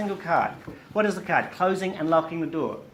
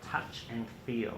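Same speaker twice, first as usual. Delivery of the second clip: slow soft touch and feel; 7 semitones lower, 8 dB quieter than usual. Shown as a demonstration. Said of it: "you need to act them out".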